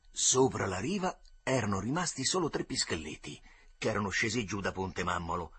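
ordinary delivery, nothing marked

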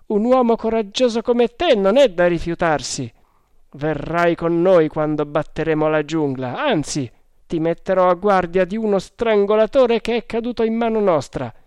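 high, loud character voice; hearty sergeant. Acted. Sergeant recruiting the brave hunter, hearty and loud. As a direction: speak up forcefully, pitched above the narration.

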